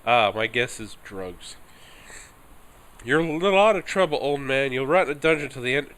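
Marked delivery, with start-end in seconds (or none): 3.0-5.9 loud, higher, fast menacing threat; bully the old man into fear; threatening guard. Acted. Guard threatening the old peddler with a dungeon, loud and fast.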